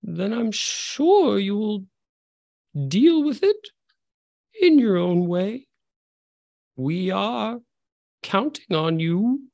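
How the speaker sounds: high, slow, drawn-out menacing voice; sinister villain. Acted. Unseen villain dismisses the cloaked figure's worry, slow and sinister. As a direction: purr it slowly like a cold antagonist.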